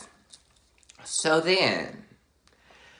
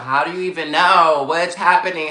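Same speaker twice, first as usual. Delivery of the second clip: louder, rushed disbelief; startled questioning; 3 semitones higher, 6 dB louder, faster than usual. Retold or imitated. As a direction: fire the questions out fast and loud. Retelling his panicked questioning of friends.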